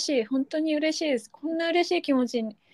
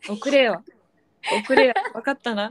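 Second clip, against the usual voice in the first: mock tough-guy order; put-on cool, commanding tone; 3 dB louder than usual. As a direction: playfully boss the listeners around. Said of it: "かっこいい".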